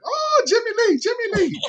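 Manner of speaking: very high squealed welcome; mock falsetto cry of joyful greeting; imitating overjoyed relatives. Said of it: "clapping and jumping up and down".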